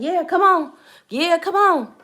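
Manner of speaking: loud, high-pitched egging-on; mock urging shout. Church woman urging her on in prayer, retold loud urging. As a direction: act out a fervent cheer, pushing someone onward.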